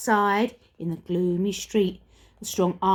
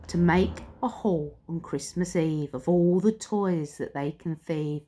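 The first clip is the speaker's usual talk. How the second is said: ordinary delivery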